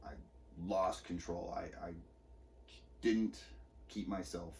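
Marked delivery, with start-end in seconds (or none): none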